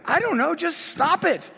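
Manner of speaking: hammed-up impatient yell; mock exasperated shout. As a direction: blurt it as a fed-up therapist. Therapist snaps at client's question.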